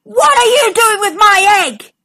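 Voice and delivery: high, loud shouted roar. Acted. Triceratops roars at Diplodocus over the egg, shouting. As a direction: bellow it at the thief. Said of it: "she roared".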